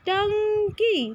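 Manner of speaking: टंकी said slowly, syllable by syllable, pitch up; teacher modelling टंकी slowly for children; slow demo of टंकी. Shown as a demonstration. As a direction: stretch out टंकी with a clear nasal ट-अं-की. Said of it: "अनुस्वार दिया तो".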